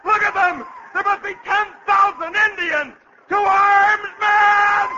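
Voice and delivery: high, loud frantic yelling; panicked shout. Acted. Aide yells at the colonel and men to fight, panicked. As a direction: scream the call to arms. Panicked alarm under attack.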